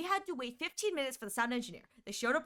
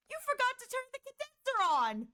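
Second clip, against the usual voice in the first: high, sing-song mock of the engineer; high teasing impression of the know-it-all engineer; mocking sound engineer; 13 semitones higher than usual. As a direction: parrot the engineer's smug little fix, way up high. Engineer's smug discovery retold.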